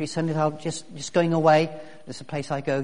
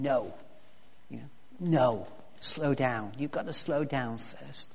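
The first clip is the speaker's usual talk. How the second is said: calm, soft command; 4 semitones lower, 9 dB quieter than usual. Retold or imitated. Shepherd calming an eager sheepdog.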